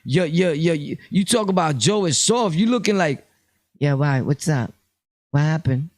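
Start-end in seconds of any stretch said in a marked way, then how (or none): 3.8-5.9 lightly voiced nervous guy, a bit lower; mock timid reply. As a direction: play it meek and unsure. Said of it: "Or timid".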